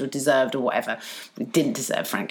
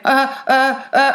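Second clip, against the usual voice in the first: higher, stuttering imitation of someone caught out; mock flustered stammer; 6 semitones higher, 4 dB louder, slower than usual. Bully caught out in front of office, mock flustered stammer. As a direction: stammer helplessly, mocking the speaker.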